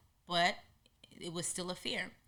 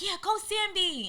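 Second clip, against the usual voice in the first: cheering; 12 semitones higher, 6 dB louder than usual. Retold or imitated. Group cheering her.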